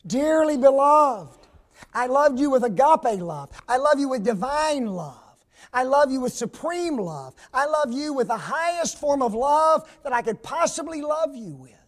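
impassioned sermon voice; fervent preaching. Acted. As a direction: preach Peter's love with full heart. Voicing Peter's love, preaching fervently.

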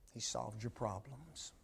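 quiet repeat. Acted. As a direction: let the repeat fall soft and intimate.

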